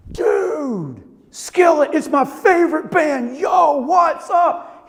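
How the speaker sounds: high, drawn-out imitation of a thrilled dude; hammed-up fan excitement. Boyfriend comes in and sees the Skillet poster, hammed-up excitement. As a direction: stretch it out, gushing like a starstruck fan.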